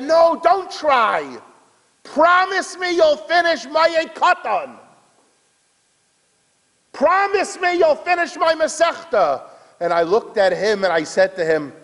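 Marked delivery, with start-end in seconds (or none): 0.0-9.4 impassioned acted deathbed demand; desperate pleading. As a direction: implore him urgently, holding the pitch high. Dying man begs for a promise.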